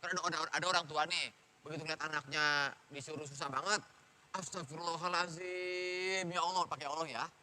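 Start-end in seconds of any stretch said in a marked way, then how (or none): 4.3-6.6 slow, drawn-out exasperated istighfar; pious nagging. Acted. Parent seeing a disobedient child, pious nagging. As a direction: scold by stretching out a holy phrase. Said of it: "Itu ngomel versi soleh".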